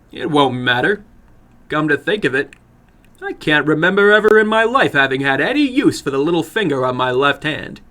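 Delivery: put-on a cocky boy shrugging off the risk. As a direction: brush it off as a bold young man. Boy talks of his finger.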